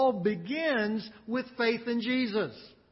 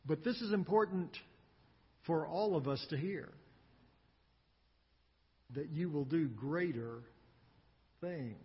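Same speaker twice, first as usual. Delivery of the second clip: hushed; 5 semitones lower, 7 dB quieter than usual. Real, not acted. Pastor softly stresses key point.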